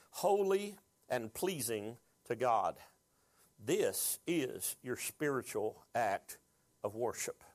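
slow, measured reading with deliberate pauses; scripture reading. Acted. Preacher reads the verse to the congregation, slow and deliberate. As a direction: read it out slowly, phrase by phrase.

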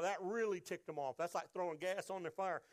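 ordinary delivery

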